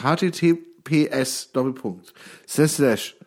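each letter said separately, "H T T P S" letter by letter; spelling it out. Shown as a demonstration. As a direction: spell out the address one letter at a time. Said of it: "buchstabiere das mal ganz kurz".

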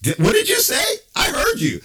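high, loud, indignant squawk; outraged caller who caught the insult; offended lady. Retold or imitated. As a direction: squawk back at the overheard insult. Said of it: "she's like".

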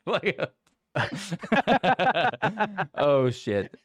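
high, loud burst of laughter; real laughing. Real, not acted. Co-host cracking up at own bit, real laughter. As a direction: crack up, then gasp out the words.

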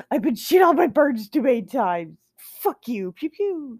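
hammed-up grumpy statue fed up with birds; mock statue voice. Voicing a bird-shooting statue.

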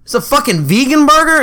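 pitched high in disbelief; can't believe the burger is vegan; outraged disbelief. Retold or imitated.

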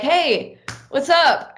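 high-pitched casual hello; bright retold greeting. Greeting a stranger in retold story.

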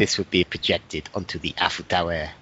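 French commentator voice, hammed up; French accent. Still playing the French commentator, mock French accent. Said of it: "It's probably my best accent".

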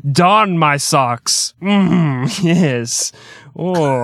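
slow, prim English moaning; mock English lover. Mocking boring English lovers, slow prim moaning. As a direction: ham up a prudish Brit in bed.

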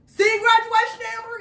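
high, loud dad's yell from a car; yelled out the window. Retold or imitated. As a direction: holler it excitedly across the driveway. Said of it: "he yelled out the window".

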